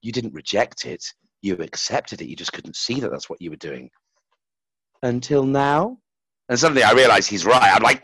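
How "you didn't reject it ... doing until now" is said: lightly voiced monk, quiet and tender; gentle wise monk. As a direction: soothe him with calm wisdom. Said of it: "And he's like".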